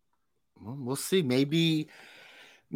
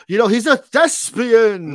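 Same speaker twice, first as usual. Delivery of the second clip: higher, louder, slow theatrical projection; pompous thespian voice; 9 semitones higher, 9 dB louder than usual. Retold or imitated. Describing the Clayface voice to a co-host, theatrical projecting. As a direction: boom it out like a stage actor hamming it up. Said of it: "he talks like this".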